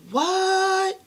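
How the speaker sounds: high, loud, drawn-out "what?"; acted-out shock, pitch shooting up; shocked reaction. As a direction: shriek it in disbelief. Storyteller acts out shocked reaction.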